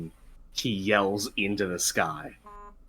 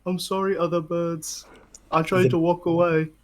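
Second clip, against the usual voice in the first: higher, sheepish apology to the birds; 7 semitones higher than usual. Acted. Kronk apologizing to the scattered birds, sheepish. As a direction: say sorry like a guilty giant.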